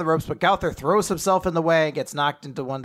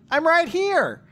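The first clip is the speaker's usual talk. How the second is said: shouted, high and loud; 14 semitones higher, 6 dB louder, slower than usual. Real, not acted. Answering the roll call loudly.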